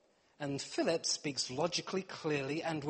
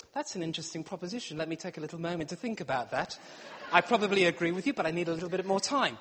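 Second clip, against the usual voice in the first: fast explanation; 2 semitones lower, faster than usual. Retold or imitated. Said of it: "what I mean is".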